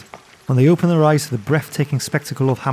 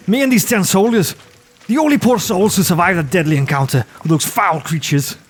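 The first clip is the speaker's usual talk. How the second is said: noble commander; 5 semitones higher than usual. Acted. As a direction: speak like a proud lord. Lord Axim introduces himself.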